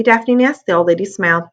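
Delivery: usual speaking voice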